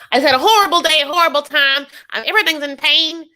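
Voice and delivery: high, loud mock whining; Angela. Angela trying to make Michael feel sorry for her, whining. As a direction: complain in a hammed-up high voice.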